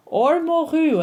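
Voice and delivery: "moru" flagged high as the mistake; wrong form moru. Shown as a demonstration. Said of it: "Moru means codfish".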